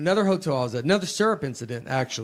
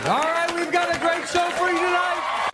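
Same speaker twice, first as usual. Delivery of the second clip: announcer voice; 12 semitones higher than usual. Acted. Host announcing the show loudly.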